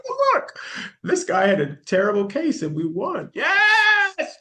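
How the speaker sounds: squealing high and loud with joy; excited cheer. Retold or imitated. To her assistant after reading of the won case, a high joyful shriek. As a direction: burst out celebrating the win. Retold joyful outburst at work.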